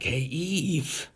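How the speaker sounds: high, hushed serpent whisper-tone; sly snake voice. Retold or imitated. Talking snake greeting Eve, high and hushed. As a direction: tempt her softly, like a snake.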